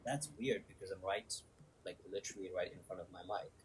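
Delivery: faint, distant, off the microphone; talking away from the phone; far from mic. Real, not acted. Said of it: "like you moved away from the phone".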